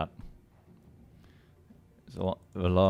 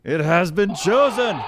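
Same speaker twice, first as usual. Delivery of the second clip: higher, louder grand announcer call; epic proclamation; 8 semitones higher, 5 dB louder than usual. Pretend. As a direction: proclaim it like a movie trailer voice. Host proclaims map choice.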